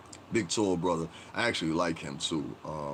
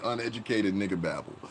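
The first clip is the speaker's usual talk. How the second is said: ordinary talk, nothing marked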